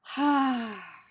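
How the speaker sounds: loud, forceful sigh out; forceful exhale. Shown as a demonstration. Teacher demonstrating forceful breathing out, loud open sigh. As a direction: blow the air out hard through the mouth. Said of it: "blowing air out of the mouth forcefully".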